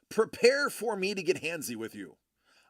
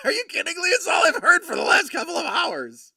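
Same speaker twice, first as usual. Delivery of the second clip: high, loud fed-up yell; exasperated shout; 13 semitones higher, 8 dB louder than usual. Retold or imitated. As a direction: blast his exasperation high. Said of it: "He's like".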